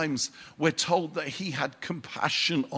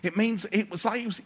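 ordinary delivery

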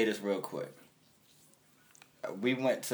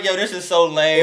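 louder, higher fed-up quote; mock complaint; 5 semitones higher, 7 dB louder than usual. Telling his friend about the boring club, mock scoff. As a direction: scoff that it's lame. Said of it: "I was like".

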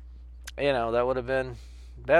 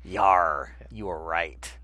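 mock pirate; 7 semitones lower, much slower than usual. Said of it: "he was like".

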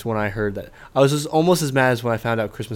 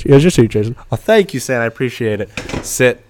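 ordinary talk

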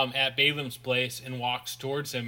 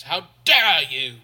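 outraged; 8 dB louder than usual. Retold or imitated. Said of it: "she gets slapped".

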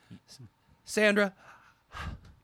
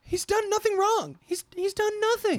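pleading wife voice; 11 semitones higher than usual. Acted. Wife protesting to officer.

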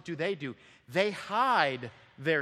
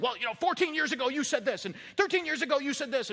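put-on fast, high, accusing listing of old wrongs; quarrelling spouse; 10 semitones higher, faster than usual. Counselor mimics a bickering spouse listing old wrongs, fast and high. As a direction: rattle off old grievances at a spouse. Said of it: "one's just saying".

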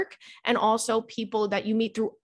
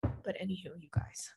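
whisper; 3 semitones lower, 12 dB quieter than usual. Real, not acted. Podcast host whispering to listeners, winding down the tangent. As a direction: drop to a breathy whisper.

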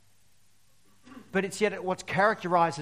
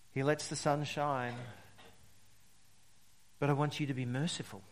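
soft, lower, measured preaching with weighty pauses; solemn scripture reading; 4 semitones lower, 6 dB quieter than usual. Acted. Preacher giving Jesus' words to the congregation, soft and solemn. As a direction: let each phrase settle, quietly and reverently.

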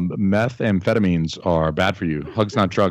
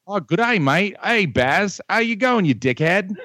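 higher, hammed-up Aussie voice; broad mock Australian; Australian accent; 9 semitones higher than usual. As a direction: overdo the Australian bloke. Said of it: "My Australian's gotten much better".

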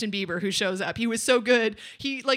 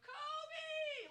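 imitating kid's yell; 18 dB quieter than usual. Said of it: "lay it up and go".

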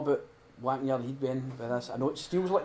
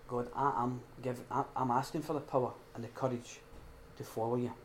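hushed prayer; 3 semitones lower, 9 dB quieter than usual. Acted. Quiet prayer to God.

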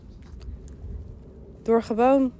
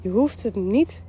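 ordinary talk

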